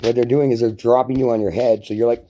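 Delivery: ordinary delivery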